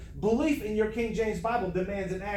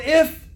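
"if" pushed out higher and louder; emphasis on IF; 5 semitones higher, 9 dB louder than usual. Shown as a demonstration. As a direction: punch the word "if" hard. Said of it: "put an emphasis on the word".

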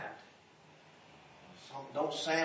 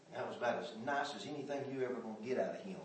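ordinary talk, nothing marked

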